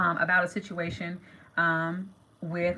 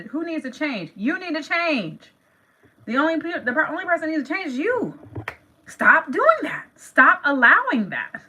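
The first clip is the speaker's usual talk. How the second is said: firm scolding; 7 semitones higher, 4 dB louder than usual. Real, not acted. Scolding herself and listeners.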